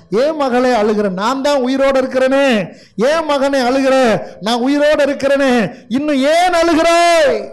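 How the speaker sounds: high, insistent preacher's cry; impassioned pleading. Acted. Risen Jesus asking his children why they weep, impassioned pleading.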